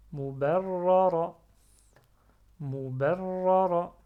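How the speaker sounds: "Mubarrara" fort et lent, r longuement roulé et retenu; lecture modèle. Shown as a demonstration. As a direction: rouler et redoubler le r de "Mubarrara". Lecture modèle d'un mot avec shadda. (« Mubarrara » articulé lentement, shadda sur le r)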